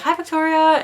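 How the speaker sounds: high, loud cheery hello; bright greeting. Retold or imitated. Cashier cheerfully greeting a regular customer, high and loud. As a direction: greet her like a favourite regular.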